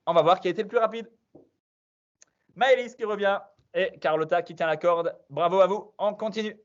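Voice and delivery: quiz-master hype; quiz host announcement. Acted. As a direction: hype the players as you read out the question. Quiz host announcing results and question.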